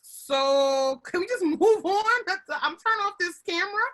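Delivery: high, strained voice of someone about to cry; overwhelmed meeting participant. Retold or imitated. Host voicing a colleague dodging a check-in, high and strained with a little laugh. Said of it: "it's like".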